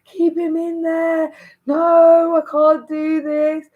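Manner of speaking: high, strained pleading cry; desperate plea in labour. Retold or imitated. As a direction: beg them in agony, high and loud. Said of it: "I was literally saying".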